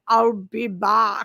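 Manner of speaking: very slow Austrian action hero voice; Arnold Schwarzenegger. Pretend. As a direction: drag it out like the Terminator. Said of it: "When I wanna do Arnold".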